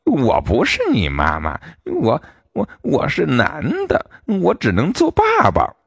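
scared fox fumbling for words; 狐狸结结巴巴地说; stammering fox. A narrator's reading. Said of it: "结结巴巴地说".

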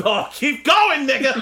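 high, loud, drawn-out cheering on; hyped-up mock viewer reaction; excited urging. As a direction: egg them on, stretching the words.

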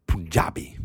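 lightly imitating a champion's strong pronunciation; mock-powerful delivery of "Punjabi"; forceful champion. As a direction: punch out "Punjabi". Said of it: "such strength".

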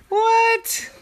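very high, drawn-out squeak; incredulous. Acted. Shark hears the koala's punchline, high incredulous squeal. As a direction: squeal it in disbelief.

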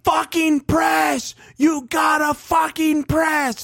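drawn-out, high-pitched mock scream from the stands; imitating a screaming heckler, stretching each word; imitated fan yelling. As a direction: yell it slow and high like an exasperated fan. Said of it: "a guy behind us yelling ... Like, screaming".

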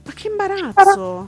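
high, disbelieving gasp of embarrassment; shocked exclamation. Real, not acted. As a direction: recoil in second-hand shame. Shocked reaction to gaffe.

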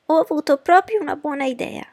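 high, loud, delighted mother; self-congratulating. Acted. Mother pleased that the rain came, crowing. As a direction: crow over your own cleverness.

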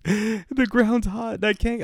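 high, slow whimper; mock a helpless tearful complaint; retold crying. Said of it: "she's fucking crying".

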